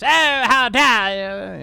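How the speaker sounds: high, loud, slow haughty outrage; pompous voice. Pretend. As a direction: play an offended snob. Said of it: "that's more like my pompous voice".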